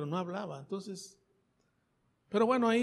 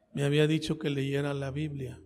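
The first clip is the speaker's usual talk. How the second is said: usual speaking voice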